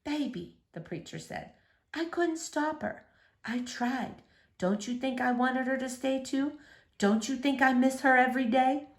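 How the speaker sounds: father answering his daughter's accusation; hushed pleading father. A narrator's reading. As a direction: plead quietly, hurting. Father pleads, near tears.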